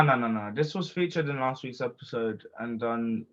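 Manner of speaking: ordinary talk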